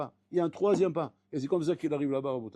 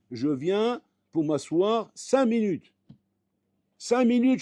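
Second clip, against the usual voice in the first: slow, loud, higher stress on cinq minutes; emphatic preaching; 8 semitones higher, 6 dB louder, much slower than usual. Acted. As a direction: hammer home each cinq minutes. Prédicateur martèle cinq minutes. (marteler lentement et fort «cinq minutes»)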